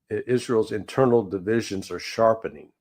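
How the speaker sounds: ordinary talk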